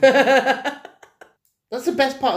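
slow, laughing insistence. Real, not acted. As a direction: laugh through the words.

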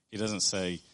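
usual speaking voice

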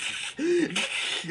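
put-on high vampire-baby noise; imitated vampire snarl. Showing the vampire baby crawling at him, imitated snarl. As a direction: mimic a little vampire creature.

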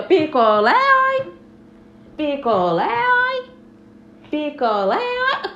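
loud cry jumping high on oi; singsong beach vendor call. Acted. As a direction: hawk popsicles across the sand. Popsicle vendor calling out.

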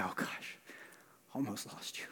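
very soft, unvoiced whisper; tender whisper. Jesus holding someone close, tender whisper. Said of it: "hold you close and say".

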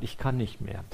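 a quiet, burnt-out admission; exhausted confession. Retold or imitated. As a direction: let "Ich kann nicht mehr" sink away, drained. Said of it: "die zu mir gesagt hat".

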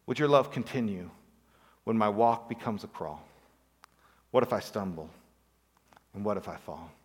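hushed, reflective recitation; recited lyric. Acted. Preacher reciting a song of doubt to the congregation, hushed. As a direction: lay the words down gently like a poem.

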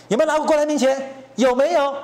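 high, loud, accusing demand; angry shout. Real, not acted. Pundit rails at the KMT, loud angry demand.

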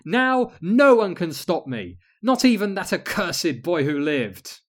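high, loud evil queen boast; gloating villain. Acted. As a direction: crow over your victory. Villain gloating after laugh.

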